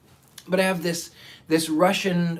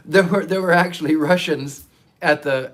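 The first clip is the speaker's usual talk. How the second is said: usual speaking voice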